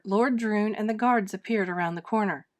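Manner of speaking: plain reading voice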